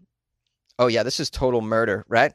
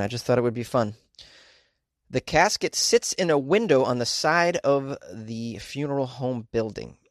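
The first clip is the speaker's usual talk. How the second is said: ordinary talk, nothing marked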